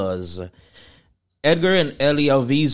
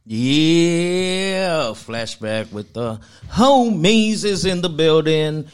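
show intro announcement; 4 semitones higher, much slower than usual. Acted.

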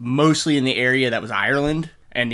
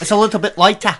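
put-on higher, playful Irish accent; mock Irish lilt; 8 semitones higher, 4 dB louder, faster than usual. Continuing accent bit.

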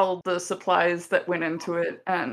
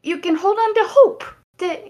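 very high, louder, earnest encouragement; Lila, hopeful comforter; 13 semitones higher, 7 dB louder than usual. Acted. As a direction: hand over hope in a high, emphatic voice. Plays Lila offering shells as hope.

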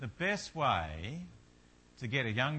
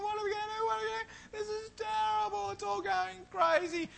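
very high, shrill cartoon panic; panicked Homer scream. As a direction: shriek like Homer losing it in the fog. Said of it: "he was screaming".

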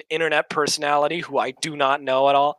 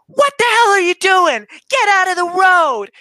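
high, loud imitating of an angry tour guide; retold yell; 7 dB louder than usual. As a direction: bark it at the taxi driver like she owns the street. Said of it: "she yells at this poor driver".

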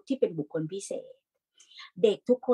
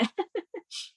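short laugh; 13 semitones higher, 6 dB quieter than usual. Real, not acted. Speaker laughs after question.

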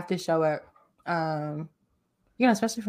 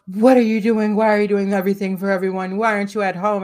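louder, insistent mock interrogation; imitated scolding; 3 semitones higher, 7 dB louder, faster than usual. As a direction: fire off questions like an exasperated friend. Imitating Christina's exasperated questioning.

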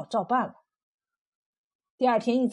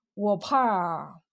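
lower, hesitant opening; uneasy and left hanging; tentative probing; 5 semitones lower than usual. A narrator's reading. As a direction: test the water cautiously. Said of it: "徐大汉试探道".

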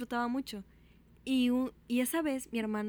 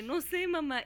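retold helplessness, pitched higher; mild mock panic, raised pitch; helpless, clueless voice; 5 semitones higher, slower than usual. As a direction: answer as if at a loss. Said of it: "se me escuchaba la voz así como de que yo no sabía qué hacer".

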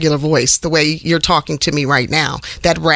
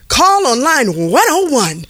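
higher, slower announcer-style page; mock receptionist announcement; 6 semitones higher than usual. Receptionist paging over the loudspeaker, hammed up. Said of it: "I would just do stuff like this over the loudspeaker".

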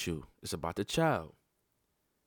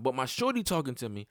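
usual speaking voice

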